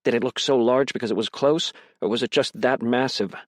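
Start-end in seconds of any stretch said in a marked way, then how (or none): none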